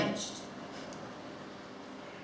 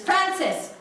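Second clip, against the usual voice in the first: angry yell; 9 semitones higher, 5 dB louder than usual. Retold or imitated. Father bursts into son's room.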